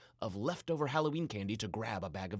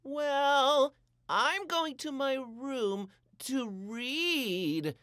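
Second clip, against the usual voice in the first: much higher, slow, grand exit; with a flourish; 13 semitones higher, 6 dB louder, much slower than usual. A narrator's reading. Jack making an excuse to sneak off, with a flourish. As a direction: announce it with a flourish. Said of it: "said Jack with a flourish".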